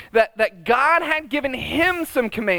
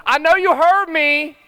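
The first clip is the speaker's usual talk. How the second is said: mock yell upstairs; 4 semitones higher, 4 dB louder, slower than usual. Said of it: "where I yell upstairs".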